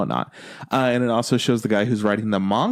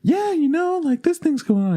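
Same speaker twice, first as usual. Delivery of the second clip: much higher, airy chirpy actress imitation; mock voice actors. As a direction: squeak it like a bubbly idol. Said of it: "the voice actors are like".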